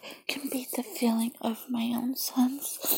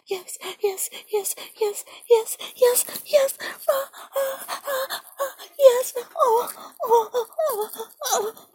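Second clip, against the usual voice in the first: much higher, louder crying out; climax cries; 12 semitones higher, 4 dB louder, slower than usual. Acted. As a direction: peak and let the voice break high. Climax in roleplay.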